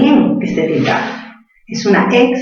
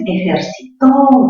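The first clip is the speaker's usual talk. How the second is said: "ejercitó" slowly, with a long final TÓ; stress on TÓ; 3 semitones higher, slower than usual. Shown as a demonstration. Teacher gives pupils the answer, stretched final TÓ. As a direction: stretch the last syllable of "ejercitó". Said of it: "Mayor fuerza en to".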